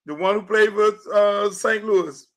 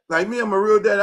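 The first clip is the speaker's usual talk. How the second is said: ordinary talk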